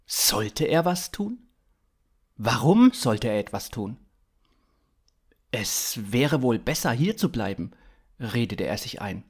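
ordinary delivery

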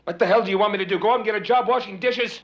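husband and wife fight about his lost job, angry; angry argument. Acted. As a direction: fire back at each other in anger. Heated marital argument over work.

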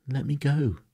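high, loud indignant command; ordering. A narrator's reading. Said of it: "she ordered him".

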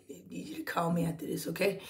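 quick, hushed aside; rushed whisper to Kenita, off to the side; private whisper. Real, not acted. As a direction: whisper it so only she hears.

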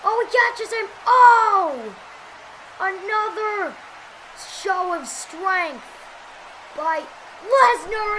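normal talking voice

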